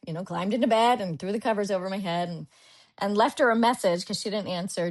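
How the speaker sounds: usual speaking voice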